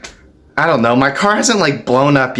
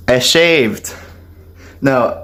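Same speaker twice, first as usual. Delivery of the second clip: ordinary talk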